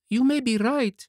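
put-on high-pitched puppet child voice; puppet Pinocchio. Puppet answers Geppetto's scolding, high childish voice.

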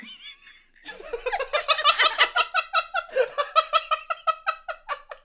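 high, loud, helpless laughter; long laughing fit. Real, not acted. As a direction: collapse into prolonged laughter. Cracks up at vegetarian line.

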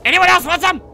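high, loud dare; shouted challenge. Pretend. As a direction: yell it out as a taunt.

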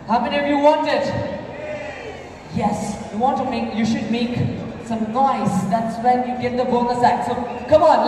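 ordinary talk

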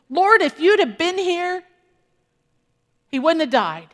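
a grieving sister blaming Jesus; Martha's complaint. Retold or imitated. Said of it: "What does she say?".

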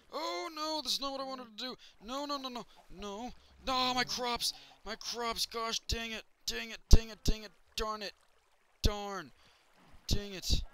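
high, loud wail of frustration over ruined crops; frustrated outburst. Real, not acted. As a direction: yelp and groan as it all goes wrong. His crops get destroyed.

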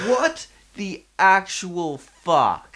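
high, loud, slow baffled outburst; stunned at the drunks outside; disbelief. Retold or imitated. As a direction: stretch it out in shock. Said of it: "I was just like".

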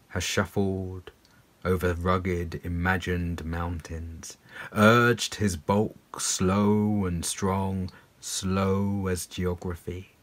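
slow, low, weighty poem reading; read slowly. Shown as a demonstration. Reading This Bear to viewers, slowly and low. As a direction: lumber through each phrase with long vowels. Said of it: "to be read slowly".

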